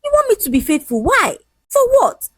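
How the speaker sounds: high-pitched indignant challenge; indignant questioning. Acted. Man refusing to be faithful, indignant. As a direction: pile up the questions, pitched well up.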